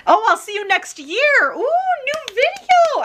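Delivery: very high, drawn-out squealy voice; playful excitement. Pretend. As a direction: stretch it out in a silly high squeal. Playful excitement about next year.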